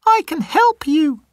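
very high, tiny mouse voice; squeaky mouse. Acted. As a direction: squeak it like a scared little mouse. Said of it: "says the mouse".

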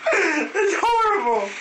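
high laughing protest. Real, not acted. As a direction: laugh through the words. Laughing at bad purchase.

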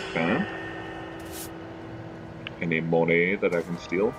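usual speaking voice

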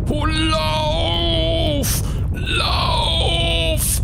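screaming in panic to run; panicked shout. Real, not acted. Fleeing eruption in game, panicking.

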